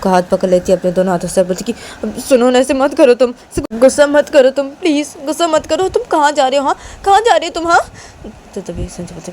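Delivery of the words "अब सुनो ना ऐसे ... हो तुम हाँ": high, loud, anxious pleading; alarmed plea rising higher; urgent pleading. Acted. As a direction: beg them to stop, pitch climbing. Uma pleads as Sanju storms out.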